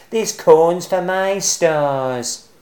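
high, loud, slow shout. A narrator's reading. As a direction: drag out a defiant yell. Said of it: "shouted Bruce".

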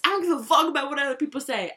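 loud, high, defiant declaration; brash and loud. Real, not acted. Defiant boast of confidence.

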